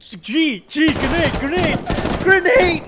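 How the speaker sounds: high urgent shouting, building; grenade warning yell. Real, not acted. Urging teammate to throw grenade.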